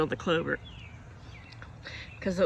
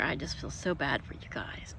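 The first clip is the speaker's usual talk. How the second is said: whispering; 4 semitones lower, 7 dB quieter than usual. Real, not acted. Sympathy for friends in heat.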